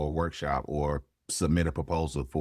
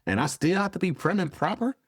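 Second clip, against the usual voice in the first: much higher, indignant disbelief; incredulous protest; 13 semitones higher than usual. Retold or imitated. Student protesting to a professor who banned cussing, incredulous. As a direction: push back in high-pitched, put-on astonishment.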